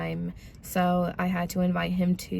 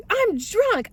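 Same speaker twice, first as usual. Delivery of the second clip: high-pitched imitation of a drunk protest; whining protest; 13 semitones higher than usual. Drunk boyfriend objecting to being kicked out, high mock protest. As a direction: protest it high, mimicking him.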